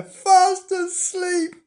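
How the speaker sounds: very high, drawn-out words; amused squeal. Acted. Imogen revealing sleeping Bernard, high amused squeal. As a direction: stretch it out high with delight. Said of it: "said Imogen with a laugh".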